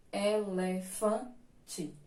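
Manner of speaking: "elefante" split slowly into e-le-fan-te, stress on fan; syllable by syllable. Shown as a demonstration. Teacher showing the class where the stress falls, syllable by syllable. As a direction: sound out "elefante" in separate syllables, leaning on fan. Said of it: "sílaba tônica de elefante é o fã".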